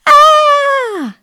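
elephant's high loud shriek; scream. Acted. Elephant Ed startled by the mouse, loud high shriek.

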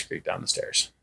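hushed, breathless creak; a hushed spooky whisper. Retold or imitated. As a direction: whisper it like a creeping step. Sound of creeping steps.

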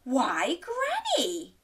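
put-on high, hesitant little girl; said slowly. Little Red puzzled by Granny, slow high wonder. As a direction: linger on it with mild wonder. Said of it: "she said slowly".

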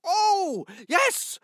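light up as it comes to you; sudden eager exclaim. Acted. Donkey remembering a story, high eager cry.

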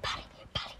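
whispered word, barely voiced; imitating what was heard. Shown as a demonstration. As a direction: whisper "poly, poly" like a remembered sound. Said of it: "I hear this, like".

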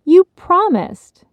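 drawn-out high indignant complaint. Acted. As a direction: whine it as an outraged little girl. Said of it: "Bridget reminded indignantly".

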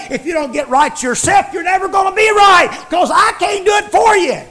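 high, loud preached shout; preaching shout. Acted. Preacher warning listeners to get right, shouting. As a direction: belt it out over the congregation.

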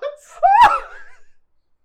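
high, loud burst of laughter; shrieking laugh. Real, not acted. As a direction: cackle out loud. Laughing at a player's joke.

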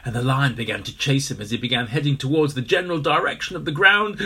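usual speaking voice

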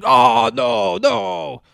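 loud, high wail of refusal; protesting yell. Retold or imitated. Retelling a man refusing a back crack, protesting yell. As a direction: howl it out, dragging the no.

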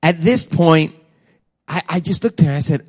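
usual speaking voice

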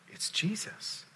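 whispered recognition; hushed, unvoiced wonder; awed whisper. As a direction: whisper it as if it has just dawned on you. Mary's awed recognition, acted in whisper.

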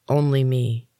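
ordinary delivery, nothing marked

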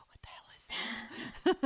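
unvoiced, hushed real reaction; whispered aside. Mom privately judging the gift, whispered. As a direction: whisper it behind his back.

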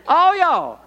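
ALL Y'ALL punched high and loud; punchy emphasis. Acted. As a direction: hit ALL Y'ALL hard, then drop. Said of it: "a little bit more punch".

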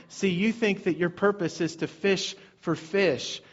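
usual speaking voice

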